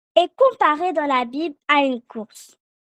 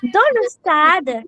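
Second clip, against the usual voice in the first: slow call to repeat; slower than usual. Shown as a demonstration. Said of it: "Répétez après moi".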